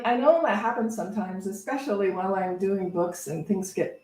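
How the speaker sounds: normal talking voice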